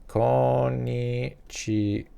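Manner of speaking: Konnichiwa drawn out very slowly, syllable by syllable; Konnichiwa spoken slowly like a language demo. Shown as a demonstration. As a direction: say Konnichiwa slowly and clearly for learners. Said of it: "Literally something so easy".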